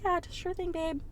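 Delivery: high, soft little phone voice; mock-sweet. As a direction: coo a fake-agreeable sign-off. Retold sweet phone sign-off.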